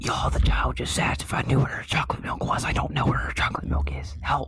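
hushed whisper to the group. Real, not acted. Hiding from child, whispering.

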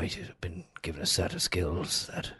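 low, quiet Liam Neeson menace; low, soft Liam Neeson threat; Liam Neeson impression. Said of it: "That's Liam Neeson".

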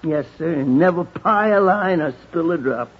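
ordinary delivery

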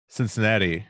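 ordinary delivery

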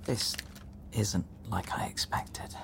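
hushed whisper aside. Real, not acted. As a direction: breathe it so she can't hear. Aside in the tiny office.

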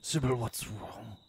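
mock solemn vet voice; slow deep interpretation. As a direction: let each word hang, deep and slow. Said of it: "Let's do our own interpretations".

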